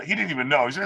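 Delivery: usual speaking voice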